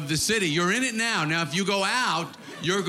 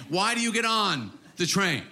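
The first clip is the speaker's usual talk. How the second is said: ordinary talk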